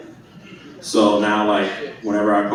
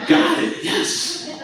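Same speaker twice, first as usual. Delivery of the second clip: squeaky dad voice; slower than usual. Retold or imitated. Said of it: "He's sitting there like".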